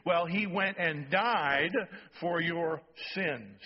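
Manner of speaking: drawn out, each word leaned on; slow, weighty sermon emphasis. Shown as a demonstration. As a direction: preach it slowly so it lands. Slow emphatic preaching repeat.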